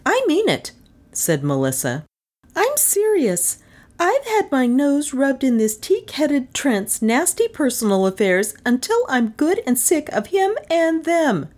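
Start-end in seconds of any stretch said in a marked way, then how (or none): 0.0-0.7 very high, indignant character voice; high, adamant protest; insistent woman. A narrator's reading. Melissa insists on her demand. 2.5-11.5 very high female character voice; high-pitched exasperated complaint; indignant fed-up woman. A narrator's reading.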